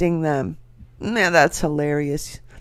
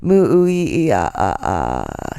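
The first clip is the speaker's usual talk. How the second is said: sinister laugh; 8 semitones lower than usual. Acted. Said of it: "I'm gonna say it again".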